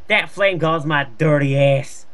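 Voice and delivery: normal talking voice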